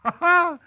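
high, loud burst of laughter; a sudden whoop of a laugh; delighted laugh. Acted. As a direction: laugh out at the trick you caught. Shelton laughs at the unmasking.

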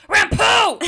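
sudden high, very loud shout; Spencer's scream. Retold or imitated. Spencer screaming as the lamp comes on, sudden shout. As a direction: scream it out to startle someone. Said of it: "Spencer screams".

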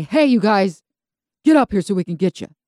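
loud, taunting call to people below. Acted. Jonathan imagining Philistines shouting to him and his servant, calling out loudly. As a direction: holler it as a challenge.

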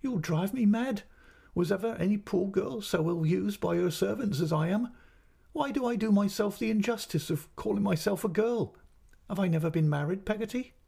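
high, distressed, aggrieved mother; tearful outcry. A narrator's reading. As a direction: lament as if driven to distraction. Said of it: "both in tears and both talking".

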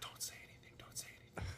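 urgent whisper; scared whisper in the middle of a meeting. Retold or imitated. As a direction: hiss it under your breath so nobody hears. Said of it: "He's like".

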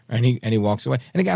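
normal talking voice

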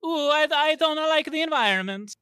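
high, loud mock Italian accent; hammed-up Italian accent impression. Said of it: "I can't do an Italian accent".

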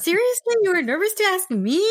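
very high, retold disbelief; incredulous. Podcaster reacting to a nervous interviewer, incredulous. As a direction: pitch it way up, can't believe it.

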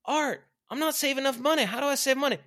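very high, loud mock plea; complaining follower. Struggling worker asking Art for advice, high mock plea. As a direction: imitate someone bringing a problem to the boss. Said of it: "people coming up to me saying".

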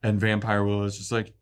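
ordinary talk

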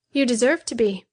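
a touch of cruelty in a woman's voice. A narrator's reading. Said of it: "said Betty cruelly".

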